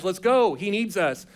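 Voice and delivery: eager Peter. Acted. Peter urging the group to rescue Lazarus, eager. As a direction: lead the charge. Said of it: "Peter wants to be the guy that's leading the charge".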